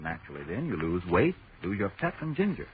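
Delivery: normal talking voice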